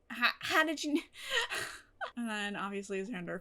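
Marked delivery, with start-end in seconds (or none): 1.1-1.8 high pained laugh near tears; high teary laugh. Real, not acted. Laughing at band scene.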